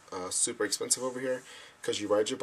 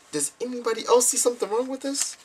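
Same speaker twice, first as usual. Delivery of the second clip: much higher, louder outraged question; incredulous; 14 semitones higher, 5 dB louder than usual. Retold or imitated. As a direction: demand if anyone else sees it. Incredulous quote after "I'm like.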